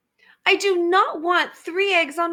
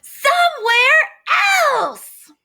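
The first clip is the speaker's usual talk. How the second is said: loud, higher, exasperated cry; frustrated shout; 7 semitones higher, 5 dB louder than usual. Acted. Elephant yells at Piggy about the birds, frustrated shout. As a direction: blurt it out, fed up. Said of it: "He is pretty frustrated".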